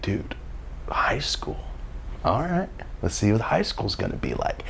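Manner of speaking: hushed whisper to himself; whispered thought. Retold or imitated. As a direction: breathe it out under your breath. Private thought in backseat.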